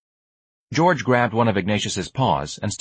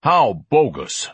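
slow, higher, drawn-out disdain; belched scorn; 5 semitones higher, 4 dB louder, much slower than usual. Acted. Ignatius dismisses George's story, belched scorn. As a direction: heave it out slowly, scornfully. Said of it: "Ignatius belched".

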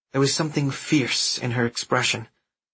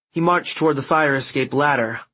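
usual speaking voice

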